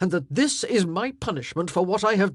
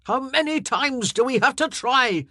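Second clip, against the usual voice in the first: loud, high, bitter outburst; a king lashing out at his queen; flung back in anger; 9 semitones higher, 8 dB louder than usual. Acted. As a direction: hurl the question back at her. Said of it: "he flung back".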